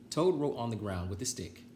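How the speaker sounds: plain reading voice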